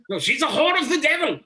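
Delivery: loud, high, hammed-up denunciation; Van Helsing. Agreeing with a fan about the film, hammed-up Van Helsing impression. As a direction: cry it out like an overacting vampire hunter. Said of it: "he was hilarious as Van Helsing".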